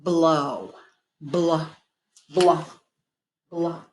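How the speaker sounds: "Blow" then "blah" repeated slowly; sounding out. Shown as a demonstration. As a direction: segment "blah" for a child. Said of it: "figure out the first part".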